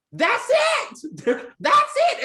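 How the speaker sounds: excited shout. Retold or imitated. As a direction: cheer it out like she nailed it. Teacher cheering student's remark.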